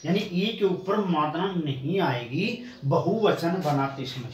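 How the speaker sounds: ordinary talk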